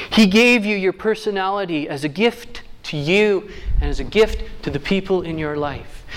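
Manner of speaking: usual speaking voice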